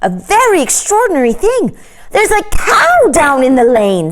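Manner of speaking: put-on high, eager childlike voices; excited children. Children at the window share news of a cow, high and eager. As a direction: squeal it out like a thrilled kid.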